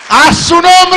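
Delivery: a preacher's ecstatic shout of triumph; triumphant shout. Acted. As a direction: shout it out in victory. Triumphant sermon punchline.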